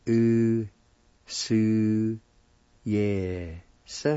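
"U, su, Yes" long and separate, with a short trailing "suh"; syllable by syllable. Shown as a demonstration. Teacher demonstrating past tense, "U, su, Yes" syllable by syllable. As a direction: sound out "U, su, Yes" with gaps. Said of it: "And it's pronounced like this".

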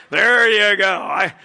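loud sneering shout; mock him with fake support; Jesus sarcastically jeering at Peter; sarcastic jab. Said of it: "that look of like".

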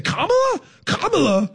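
high, incredulous coach's question; mock-outraged high-pitched impersonation; Jim Mora. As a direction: repeat the name in disbelief like an exasperated coach. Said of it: "a Jim Mora voice like".

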